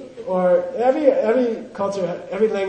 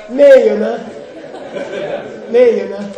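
calls "Ne, you know?" loud, with a rising end and laughing; loud drill; 3 semitones higher, 8 dB louder than usual. Shown as a demonstration. Teacher quizzes the class, loud and laughing. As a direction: bark the question at the class, grinning.

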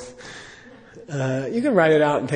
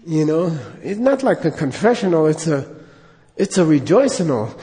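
ordinary delivery

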